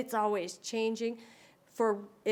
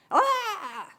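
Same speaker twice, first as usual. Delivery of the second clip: sharp high gasp of fright; mock startled yelp; 11 semitones higher, 5 dB louder than usual. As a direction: gasp as if jumped at from behind. Kid says boo, startled.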